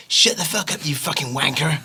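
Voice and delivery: high-pitched West Country swearing; West Country accent. Shown as a demonstration. Writer recalling his home accent by swearing, West Country accent. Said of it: "swear to get into the accent".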